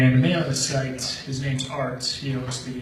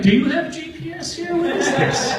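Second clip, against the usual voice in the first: mock disbelief; 14 semitones higher, 4 dB louder than usual. Narrator mocking driver in disbelief.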